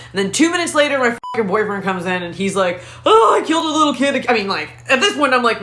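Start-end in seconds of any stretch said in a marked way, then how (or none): 3.0-4.2 hammed-up tearful confession; mock wailing. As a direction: wail it like a distraught boyfriend. Boyfriend's sobbing meltdown acted out.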